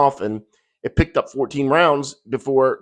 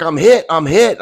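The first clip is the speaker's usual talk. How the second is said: louder, higher-pitched cry of alarm; imitated panicked cry; 4 semitones higher, 6 dB louder than usual. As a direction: call out like the wounded passenger. Acts out shot passenger crying out.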